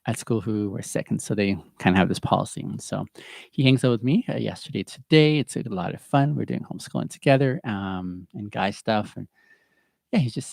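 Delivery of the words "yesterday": ordinary talk, nothing marked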